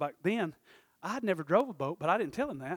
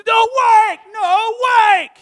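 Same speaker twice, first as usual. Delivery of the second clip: much higher and louder warning shout; hollering; 22 dB louder, much slower than usual. Retold or imitated. People on the dock yelling at the reckless boat driver. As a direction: yell it across the water at a speeding boat. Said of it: "I hear people".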